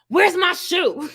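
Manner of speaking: slow, loud shout cracking into laughter; laughing shout. Retold or imitated. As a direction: yell for the shoe, half laughing. Cousin shouting for lost shoe.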